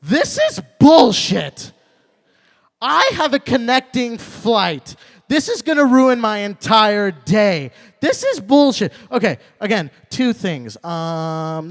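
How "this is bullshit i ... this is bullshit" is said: high, loud, slow indignant rant; angry lady. Retold or imitated. Lady next to me complains about the landing, loud slow rant. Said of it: "the lady next to me looks at me and she goes".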